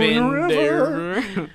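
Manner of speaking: drawn out high and slow; slow teasing echo. Pretend. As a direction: stretch it out teasingly.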